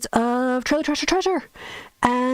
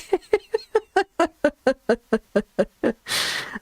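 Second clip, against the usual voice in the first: long laugh; 5 semitones higher than usual. Real, not acted. Laughing at chat comment.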